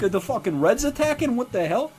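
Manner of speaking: high-pitched panicky questioning; retold alarm. Seeing a mushroom cloud in Nevada, alarmed.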